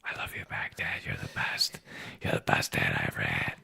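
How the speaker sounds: soft whisper, feeding the line; whispered prompt. Real, not acted. Dad whispering words for his son to say. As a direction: whisper it as a prompt for him to repeat.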